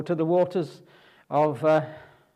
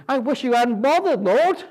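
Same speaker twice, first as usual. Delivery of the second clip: higher, louder complaint of a worn-out Moses; exasperated Moses; 7 semitones higher, 4 dB louder than usual. Retold or imitated. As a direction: Moses venting to the Lord about his people. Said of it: "he's almost saying".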